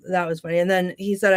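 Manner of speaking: ordinary delivery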